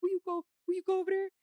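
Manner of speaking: rushed, urgent recruiting; urgent sister's plea. Retold or imitated. Sister urging her to come fight.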